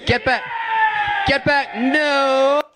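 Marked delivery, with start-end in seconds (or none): none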